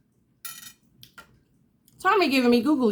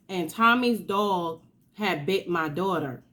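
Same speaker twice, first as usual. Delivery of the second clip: usual speaking voice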